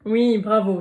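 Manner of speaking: ordinary delivery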